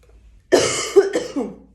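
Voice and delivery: short loud sick cough; loud hacking cough; coughing fit. Real, not acted. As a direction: hack it out, then apologise. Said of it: "Oh, sorry".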